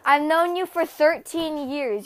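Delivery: ordinary talk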